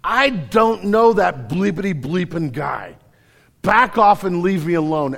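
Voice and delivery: acted annoyance, firmly pushing them away; mildly angry. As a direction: play Peter's irritation with moderate force. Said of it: "Peter looks in anger and says".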